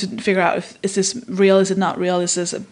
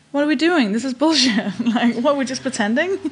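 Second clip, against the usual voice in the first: ordinary talk, nothing marked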